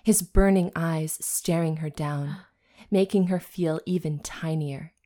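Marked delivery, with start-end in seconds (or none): 0.0-3.3 whispered narration. A narrator's reading. Narrator whispering the demon's tension. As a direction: let it hang, whispered.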